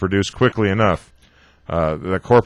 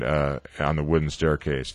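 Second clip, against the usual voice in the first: plain reading voice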